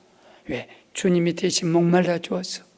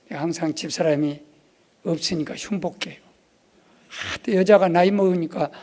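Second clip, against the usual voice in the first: normal talking voice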